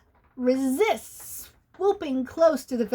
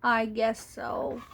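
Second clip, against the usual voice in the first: sheepish; 3 semitones lower, 5 dB quieter, slower than usual. Acted. Benny admits sheepishly.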